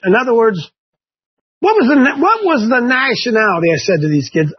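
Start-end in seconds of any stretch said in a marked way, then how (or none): none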